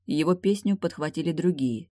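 usual speaking voice

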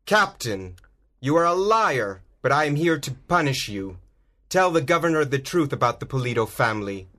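lightly voiced furious swordsman; accusing hero. A narrator's reading. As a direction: call the captain a liar as Zoro. Zoro accuses the captain.

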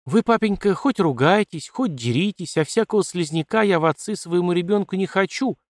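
firmly cutting. Acted. Daughter firmly refuses a weak husband, decisive. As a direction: shut the matter down. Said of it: "решительно отрезала Катерина".

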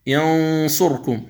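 ينظرون drawn out with the nasal hum held; slow tajweed recitation. Shown as a demonstration. Teacher demonstrating ikhfa before ظ, slow recitation. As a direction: chant ينظرون slowly through the nose. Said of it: "عند الصاد".

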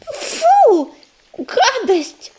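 high, squealing disgust. Acted. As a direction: spit the words out in revulsion. Sick kitten spits out medicine.

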